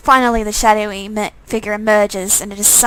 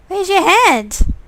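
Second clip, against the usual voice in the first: surprised; 8 semitones higher, 3 dB louder, slower than usual. Acted. Player's own shocked reaction.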